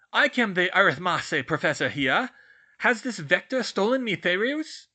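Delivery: loud, high archaic indignation; outraged portrait. Acted. Medieval portrait suspects a rival professor, loudly outraged. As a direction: rant in an old-world accent.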